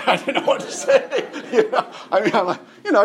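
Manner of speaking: high laughing talk. Real, not acted. Preacher retelling the awkward nursing home moment, laughing. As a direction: laugh through the words, still amused. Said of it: "I laughed".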